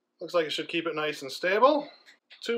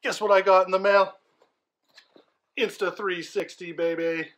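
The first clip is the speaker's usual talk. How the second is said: bursting with excitement, louder; excited; 4 semitones higher, 5 dB louder than usual. Real, not acted. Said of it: "I am so excited today".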